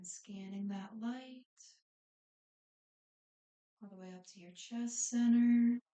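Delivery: unhurried breathing cues, phrases spaced far apart, softening as it goes; guided meditation. Real, not acted. Yoga instructor settles the class into breathing and body scan, guided meditation.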